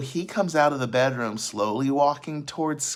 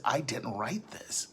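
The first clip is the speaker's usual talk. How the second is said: a stunned, slow whisper; shocked whisper; 8 dB quieter, slower than usual. Retold or imitated. Dealer sees a text he doesn't remember sending, whispering in shock. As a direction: breathe it out in disbelief. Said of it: "is in complete shock".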